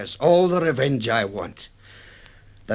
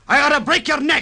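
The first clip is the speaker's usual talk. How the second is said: a high, loud roar of rage; furious threat; 11 semitones higher, 5 dB louder than usual. Acted. Captain seizing the shipowner by the collar, with a roaring threat. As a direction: threaten him like you mean to strike.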